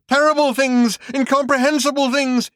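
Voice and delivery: high, loud horrified shout; frantic shouted horror. Acted. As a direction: shout it from his elbows. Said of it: "he shouted".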